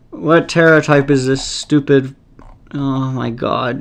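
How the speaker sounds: ordinary talk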